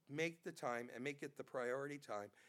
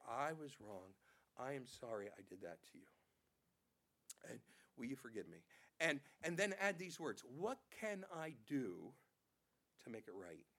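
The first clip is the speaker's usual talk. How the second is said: much lower and softer, quiet and contrite; humble apology; 7 semitones lower, 5 dB quieter than usual. Shown as a demonstration. Preacher modeling an apology to a brother, soft and humble. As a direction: say it humbly, head bowed in the voice.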